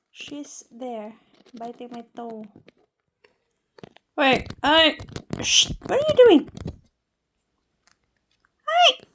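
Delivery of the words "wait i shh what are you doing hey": high, loud startled shout; real alarmed yell. Real, not acted. Owner yelling at bird pulling her nails, high and loud. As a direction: cry out as it pulls a nail.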